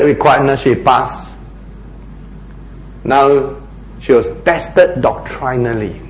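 usual speaking voice

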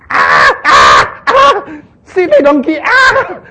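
laughing mock shout, pitch leaping up and down; retold screaming. Balaam yelling at his donkey, a laughing mock scream. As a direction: yell at the stubborn donkey while half laughing. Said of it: "Balaam was screaming".

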